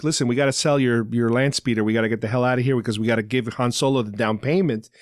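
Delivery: ordinary talk, nothing marked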